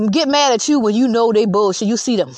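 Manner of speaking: normal talking voice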